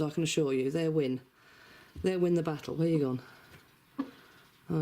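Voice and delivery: usual speaking voice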